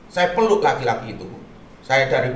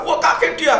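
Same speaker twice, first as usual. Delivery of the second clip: startled; 5 dB louder than usual. Retold or imitated. Recounts the man's startled reaction.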